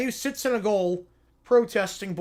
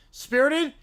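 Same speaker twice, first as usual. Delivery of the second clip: higher, disbelieving echo of spirited; incredulous echo; 6 semitones higher, 4 dB louder than usual. Acted. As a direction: throw the word Spirited back in disbelief. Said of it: "uh, spirited".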